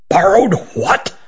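understated roar of disbelief; mild bellow. A narrator's reading. Old magician hears they took the raven, mild bellow. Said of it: "bellowed Speckeren".

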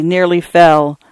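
plain reading voice

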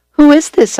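high, loud startled question; surprised. A narrator's reading. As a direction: blurt it out in surprise.

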